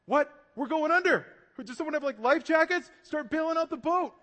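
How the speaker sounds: high, loud mock panic; freaked out. Panicked sleeper woken on a sinking boat, freaked-out shouting. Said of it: "Jesus isn't freaked out".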